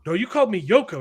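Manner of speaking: mock Beatle British accent, insistent. Mary insists to Jesus, in a mock [name] British accent. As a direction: lightly imitate a British Beatle arguing back. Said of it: "they're all talking in their British accents".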